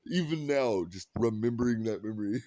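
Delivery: ordinary talk, nothing marked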